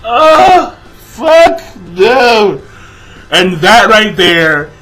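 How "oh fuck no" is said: dismayed refusal. Retold or imitated. Retells own reaction to cliffhanger.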